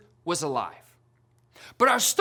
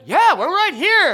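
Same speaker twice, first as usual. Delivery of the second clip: calling back; 6 semitones higher, 7 dB louder than usual. Retold or imitated. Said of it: "all of my friends went".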